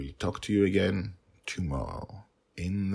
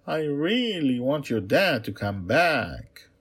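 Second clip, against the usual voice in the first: high, slow, pleading princess; 7 semitones higher, 5 dB louder than usual. Acted. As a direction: beg slowly in the princess voice.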